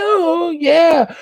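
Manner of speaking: high theme shout. Retold or imitated. Streamer imitating theme's oh yeah, high. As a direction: shout it like the music.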